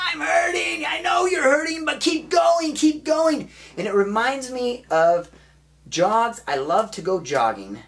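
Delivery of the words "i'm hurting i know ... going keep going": very high, loud hammed-up cries; frantic high-pitched mock screaming; anguished yelling. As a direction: yell it out as player and coach at the breaking point. Acting out pained player, yelling coach.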